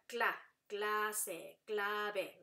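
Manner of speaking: "cla" as one sound, then "clase", "clave" slowly by syllable; cluster demonstration. Shown as a demonstration. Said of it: "they make the sound".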